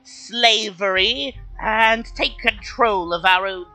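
high, slow, formal little kobold. Acted. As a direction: speak as a polite high-pitched kobold. GM voices kobold.

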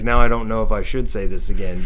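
ordinary talk, nothing marked

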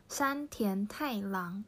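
山田太郎 said slowly, syllable by syllable; slow name demo. Shown as a demonstration. Teacher repeating the example name, slow and clear. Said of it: "と言ってました".

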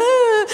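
high, loud hammed-up crying wail; a hammed-up sob burst out loud; mock wail. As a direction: wail like you're sobbing in public. Crying in airports in public.

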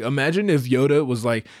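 ordinary talk

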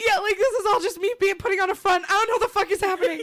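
admitting the bravado is a front; nervous laughing. Real, not acted. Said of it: "I'm actually legitimately scared".

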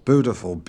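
"beautiful" as "boo-tiful", no y; yod-dropped pronunciation. Retold or imitated. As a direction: say "beautiful" with a plain oo. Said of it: "every time he said".

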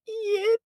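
very high, sharp squeak; squeaky eep. Retold or imitated. Retelling the window sound effect, high mock squeak. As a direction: imitate a tiny cartoon squeak. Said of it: "you hear".

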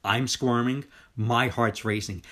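usual speaking voice

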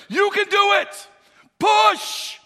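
high, loud coaching yell; spotter's urging shout. Acted. Spotter urging a struggling lifter on, shouting hard. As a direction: yell them through the last rep. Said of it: "and he goes".

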